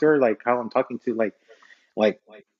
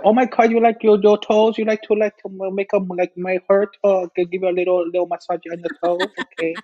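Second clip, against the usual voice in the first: higher, fussing salon worker voice; nail technician; 5 semitones higher than usual. Acted. Nail tech offers a toe massage, put-on salon voice. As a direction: play an accommodating nail tech.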